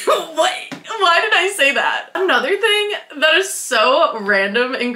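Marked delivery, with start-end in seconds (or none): 0.1-2.1 high laughing disbelief; laughing at herself. Real, not acted. Vlogger laughing at her own silly compliment, laughing high. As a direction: laugh through the question in disbelief.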